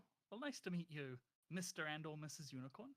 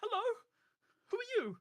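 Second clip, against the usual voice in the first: high, loud friendly yell of a greeting; screaming greeting; 9 dB louder than usual. Acted. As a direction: call out loud and cheery. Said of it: "Yoke screams this".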